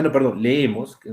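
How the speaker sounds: usual speaking voice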